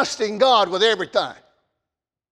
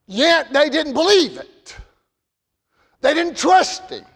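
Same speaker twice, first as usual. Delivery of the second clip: normal talking voice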